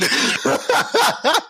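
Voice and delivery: high-pitched cackle; high laugh. Real, not acted. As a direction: cackle at your own joke. Laughing at own joke.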